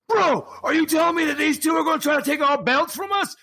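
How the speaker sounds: very high, strained mock yell; over-the-top high-pitched promo shouting; outraged wrestler. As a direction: holler it like an indignant tag champion. Champion acts outraged wrestling promo.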